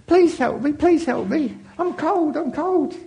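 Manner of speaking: high, pleading little creature voice; pleading snake voice. Acted. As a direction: beg like a small cold snake. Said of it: "started to speak to her".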